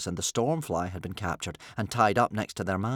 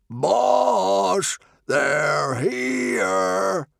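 long, loud call with drawn-out words; shout; 11 semitones higher, 6 dB louder, much slower than usual. A narrator's reading. Barbarian calling to his boss in the tent, loud drawn-out shout. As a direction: holler it across the deck. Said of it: "and shouted".

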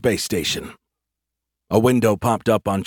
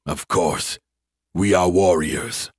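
low alien growl on Of course; 3 semitones lower, slower than usual. Acted. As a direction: drop low and slow like an alien warrior. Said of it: "an alien voice growled".

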